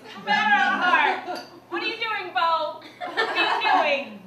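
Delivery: overwrought soap-opera plea; a hint of daytime melodrama; soap drama. Soap heroine pleading with Bo, mock-dramatic.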